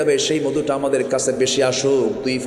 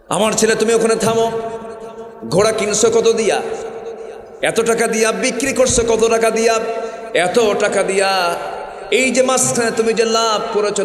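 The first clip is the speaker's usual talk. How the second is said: stern father; 9 semitones higher than usual. Acted. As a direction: call the son to stop and demand answers. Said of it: "যখন বাড়ির মধ্যে ঢুকতে এসে বলছে".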